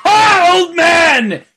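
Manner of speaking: high loud delighted shout; joyful greeting. Acted. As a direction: greet an old friend with a big shout. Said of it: "He goes".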